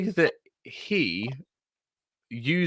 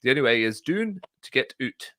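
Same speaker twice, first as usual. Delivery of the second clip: put-on light Scottish accent, broad vowels on doon and oot; bit of Scottish accent. Podcast host quoting the villain from the trailer, in a bit of Scottish accent. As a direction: imitate the film's villain with a slight Scottish lilt. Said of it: "he does a bit of a Scottish accent".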